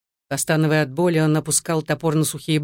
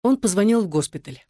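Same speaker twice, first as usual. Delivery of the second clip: ordinary delivery, nothing marked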